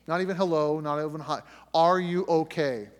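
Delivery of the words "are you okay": slow, loud and tight; slow, loud, tense question to a missing wife; tense demand. Retold or imitated. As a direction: press each word out, scared and stern. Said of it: "she can hear the tension".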